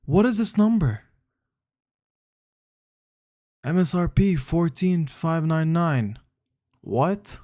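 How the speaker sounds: stunned by the number; shocked disbelief. Real, not acted. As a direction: pitch jumps up in shock. Shocked at fridge price.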